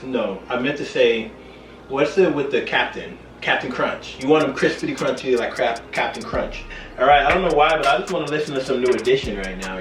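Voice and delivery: usual speaking voice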